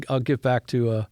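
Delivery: ordinary talk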